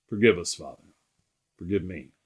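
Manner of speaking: low, soft plea; hushed repentant prayer. Real, not acted. Preacher asking God the Father's forgiveness, low and repentant.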